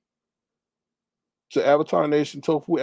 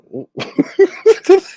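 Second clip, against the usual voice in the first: high, loud cackling laugh; real laugh; 6 dB louder than usual. Real, not acted. Host reading a viewer's jab, cracking up.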